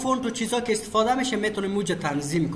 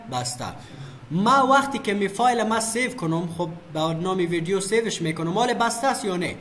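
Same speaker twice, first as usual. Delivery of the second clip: usual speaking voice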